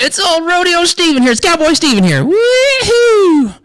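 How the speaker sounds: high, loud cowboy self-introduction; hammed-up cowboy holler; whooping rodeo cowboy. Cowboy persona announces himself, whooping.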